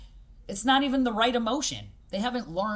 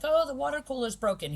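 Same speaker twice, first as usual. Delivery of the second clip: put-on higher, whiny little-kid complaint; imitating a little kid; 7 semitones higher than usual. Coach imitating a five-year-old at the cooler, higher kid voice. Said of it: "and he was like".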